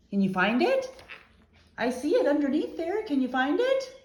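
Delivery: high, encouraging pet-talk; urging the dog to find his buried toy, high and coaxing. Real, not acted. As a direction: urge the puppy on.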